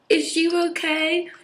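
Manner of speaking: high, drawn-out fake sympathy voice; mock the girl waiting to be comforted; mock girly concern. Mocking what the crying girl wants to hear, in a high drawn-out voice. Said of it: "obviously wanting someone to be like".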